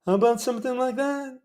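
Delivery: a lightly done Seinfeld question; Jerry Seinfeld. Pretend. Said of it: "My bad Jerry Seinfeld impression".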